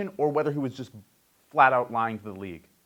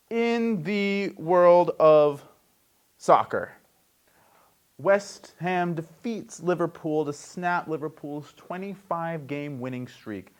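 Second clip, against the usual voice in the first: slow, drawn-out anchor headline; sports news read; 4 semitones higher than usual. Acted. Host reads soccer result, slow news read. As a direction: announce it deliberately, stretching the opening.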